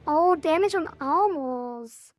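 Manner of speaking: normal talking voice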